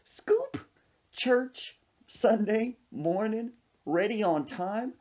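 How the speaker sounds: put-on high, quick old lady voice; grandma giggling. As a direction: list it high between giggles. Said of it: "She said".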